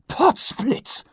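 high, bursting-out gasp of wonder; Lady Ramkin exclaiming, high; surprised exclamation. A narrator's reading. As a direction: let it escape in amazement. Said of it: "entfuhr es Lady Käsedick".

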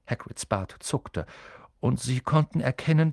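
usual speaking voice

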